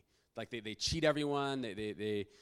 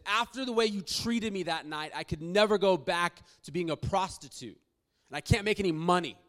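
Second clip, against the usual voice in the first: high, loud, accusing cry; anguished outburst; 6 semitones higher, 7 dB louder than usual. Retold or imitated. Woman accusing the man who changed her life, anguished. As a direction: blame him with raw anguish.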